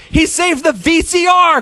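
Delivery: father praising the dog, excited; cried out. A narrator's reading. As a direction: shout his joy like a proud dad. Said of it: "cried Father".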